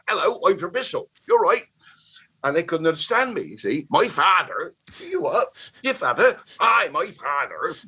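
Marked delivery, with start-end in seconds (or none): 0.1-1.6 lightly imitated Bristol accent, pitched higher; mock his childhood Bristol accent. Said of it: "I was talking like that". 3.9-4.8 a hint of Bristol accent on "My father". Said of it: "I was talking like that". 5.0-5.5 very high incredulous "You what?"; baffled Bolton kid. Retold or imitated. Bolton kids not understanding. 5.8-6.4 mock correction. Kids correcting his accent. 6.6-7.7 mock Lancashire. Adopting the Lancashire way.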